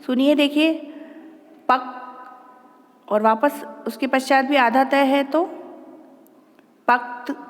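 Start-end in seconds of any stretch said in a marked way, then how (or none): 1.7-1.8 पक with a barely released क; half-sounded consonant. Shown as a demonstration. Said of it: "बिल्कुल अल्प उच्चारण करेंगे". 6.9-7.3 पक्त short and soft, क and त barely released; half-sounded consonants. Shown as a demonstration. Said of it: "अल्प उच्चारण होता है उसका".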